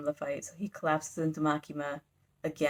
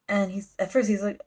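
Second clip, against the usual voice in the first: ordinary delivery, nothing marked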